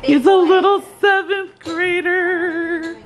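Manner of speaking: high, slow sing-song fake weeping; teasing a little brother with mock tears; mock crying. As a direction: pretend to be moved to tears, hammed-up. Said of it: "I'm crying".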